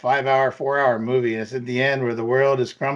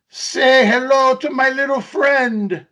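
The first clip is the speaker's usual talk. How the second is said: big, drawn-out film quote, high and loud; movie-quote impression; 9 semitones higher, 5 dB louder, slower than usual. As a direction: belt out the movie line slowly. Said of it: "try to say it with the proper, proper way".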